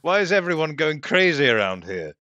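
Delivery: high, put-upon complaint; indignant outburst; exasperated protest. Acted. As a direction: cry out in acted exasperation. Accused character protests.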